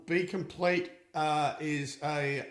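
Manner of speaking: ordinary talk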